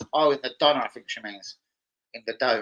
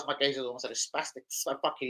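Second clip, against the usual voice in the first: ordinary talk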